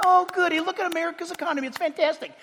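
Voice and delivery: high, soft, quick gushing; mock delight. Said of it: "They weren't happy. They weren't going".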